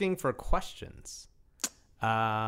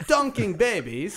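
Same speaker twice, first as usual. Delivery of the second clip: put-on Australian accent; 7 semitones higher, 3 dB louder, slower than usual. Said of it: "in Australian accent".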